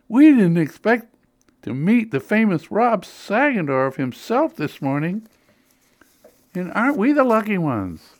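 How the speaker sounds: put-on high, eager starstruck old ladies; gushing enthusiasm. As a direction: gush over a local celebrity. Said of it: "one onslaught of enthusiasm".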